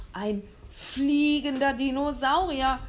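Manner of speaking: a hunter losing his mind at the sky; howling. Acted. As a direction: howl it to the sky. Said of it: "heulte er wie ein Geisteskranker".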